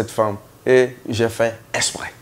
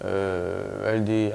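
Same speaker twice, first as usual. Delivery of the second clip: ordinary delivery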